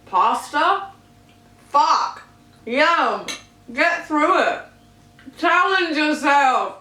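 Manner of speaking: hyped up. Pretend. Psyching herself up to eat pasta.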